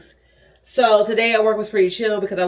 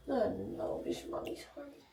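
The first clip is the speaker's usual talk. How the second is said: whisper; 4 semitones higher, 17 dB quieter, faster than usual. Real, not acted. Whispered to dog.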